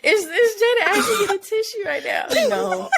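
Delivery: very high, emotional laughing squeal; high-pitched, choked-up amusement; laughing disbelief. Real, not acted. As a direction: squeak it through tearful laughter. Teasing friend tearing up, laughing.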